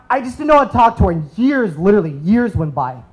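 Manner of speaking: ordinary talk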